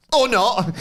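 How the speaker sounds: very high, loud gloating quip; gleeful mock jab. Finn gloating as Dom dodges, very high loud jab. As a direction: savour the jab. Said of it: "He's absolutely loving this".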